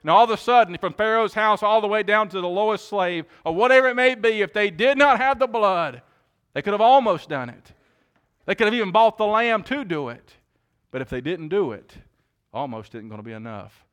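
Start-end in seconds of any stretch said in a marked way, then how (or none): 0.0-10.4 high, loud sermon peak; preaching at full force. Acted. Preacher warns the congregation about the Passover night, full-force preaching. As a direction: drive it home to the pews, full voice.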